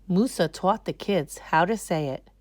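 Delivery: ordinary delivery, nothing marked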